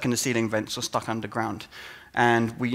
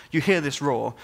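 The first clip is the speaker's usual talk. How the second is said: ordinary delivery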